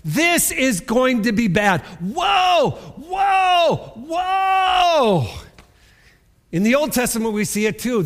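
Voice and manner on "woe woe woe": high, loud drawn-out woe; angel's cry. Acted. Preacher repeating the angel's woe, loud and high. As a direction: wail each woe out long. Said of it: "repeating the woe".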